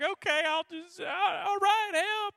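hammed-up wailing plea for help; very high, loud mock sobbing; crying. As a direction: give in through exaggerated tears. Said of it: "I've just been crying".

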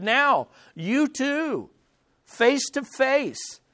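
slow, deliberate words to two men; slow mediator. Retold or imitated. Paul gently bringing the two men together, slow. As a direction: spell it out slowly, like a go-between.

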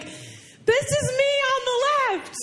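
high, drawn-out exclamation; mock wail. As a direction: squeal it slowly as a retold reaction. Acted reaction to manual picture.

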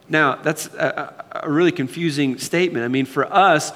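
usual speaking voice

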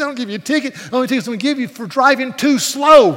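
loud, high, exasperated shout; retold shout. Preacher yells at his driver to hurry up.

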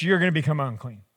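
ordinary delivery

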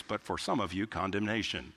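dropped low, like side-effect small print; mock disclaimer. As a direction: slip in the bad news low. Parody of commercial's grim disclaimer.

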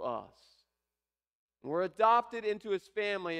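preaching at full force. Acted. Preacher to congregation on adoption, full-force preaching. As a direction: proclaim it to the whole congregation. Preacher builds to the key point.

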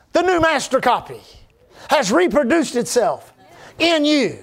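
driving sermon delivery with punched key words; emphatic preaching. Real, not acted. Preacher tells congregation of their new identity, emphatic preaching. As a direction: preach it in rising and falling phrases.

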